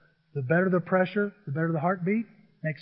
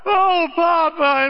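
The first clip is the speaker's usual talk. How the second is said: slow, high, loud, delighted "Oh, Papa"; adoring child; 10 semitones higher, 7 dB louder, much slower than usual. Retold or imitated. Little girl greeting her grandpa: slow high adoring cry. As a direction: stretch it out like a child melting into a hug.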